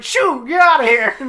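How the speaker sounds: high, loud comic shooing; mock shooing. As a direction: chase it off in a laughing shout. Acts firemen shooing the creature.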